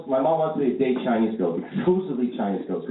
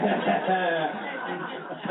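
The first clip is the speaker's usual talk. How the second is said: mocking laugh; 8 semitones higher, 4 dB quieter than usual.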